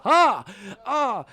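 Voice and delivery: high, loud nervous "ha-ha!"; showman's cry. Acted. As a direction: shout a forced laugh to the crowd. GM voices nervous juggler's cry.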